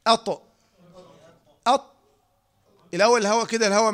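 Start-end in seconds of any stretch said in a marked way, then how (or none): 0.1-1.9 "أط" with the tongue sealed to the palate; tongue-sealed articulation. Shown as a demonstration. Teacher shows the sealed sound, "أط" demonstrated firmly. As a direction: stop the air hard on "أط". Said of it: "ولكن الحقيقة إن هي فيها إطباق".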